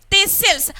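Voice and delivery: "thistles" as this-sils with a held S; stressed S, silent T. Shown as a demonstration. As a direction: lean on the S in "thistles", no T. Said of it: "I gave stress to this letter S".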